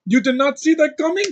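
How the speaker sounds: high, loud put-on foreign accent; Aaron Taylor Johnson. Reviewer quoting an accent he liked, high-pitched accent imitation. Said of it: "I liked his accent in".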